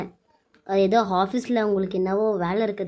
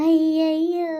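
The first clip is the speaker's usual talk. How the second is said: playful surprise; 7 semitones higher, 4 dB louder, much slower than usual. Acted. Wife reacts to husband's song.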